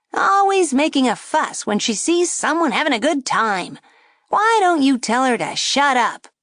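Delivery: high, loud wisecracking puppet; a brash ventriloquist dummy needling; cheeky dummy voice. A narrator's reading. Dummy mocking the girl at the table, high and brash. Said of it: "the dummy said to the man".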